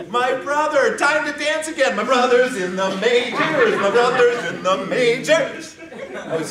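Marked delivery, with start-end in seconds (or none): 0.1-1.9 high, loud joyful cheer; excited shout. Retold or imitated. Sibling hearing of the promotion, excited shout. Brother hired by majors. 1.9-3.8 excited cheer. Retold or imitated. Repeated celebration chant. 3.8-5.8 louder, laughing through the cheer. Retold or imitated. Sibling repeating the news, laughing cheer.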